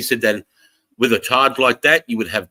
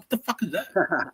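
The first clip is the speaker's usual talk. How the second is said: baffled disbelief; 10 semitones higher, 8 dB quieter than usual. Retold or imitated. Retelling own baffled reaction.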